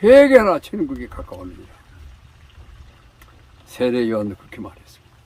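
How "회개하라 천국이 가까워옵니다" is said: proclaiming call. Acted. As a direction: thunder the call to repent like a prophet. Preacher proclaiming repentance.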